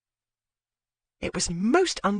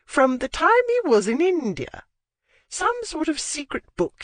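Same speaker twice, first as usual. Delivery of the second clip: put-on old lady voice; 11 semitones higher, 3 dB louder than usual. A narrator's reading. Lady Withcote voiced high.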